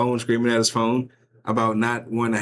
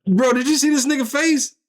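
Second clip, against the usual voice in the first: disbelief; 12 semitones higher than usual. Real, not acted. Reacting to the clip in disbelief.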